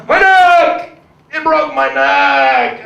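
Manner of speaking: high pained yell, slow at the end. Retold or imitated. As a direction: cry out as the hit fan. Fan hit by ball.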